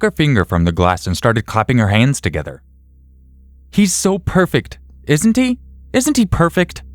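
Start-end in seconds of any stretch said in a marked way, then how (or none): 3.7-6.8 high-pitched giddy gushing; much higher, delighted cooing; overcome with joy. A narrator's reading. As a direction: squeal it high, like a giddy new mother. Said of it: "as if she was overcome with joy".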